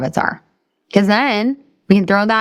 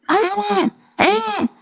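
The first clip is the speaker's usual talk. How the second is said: mock wail; much slower than usual. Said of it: "Falls down".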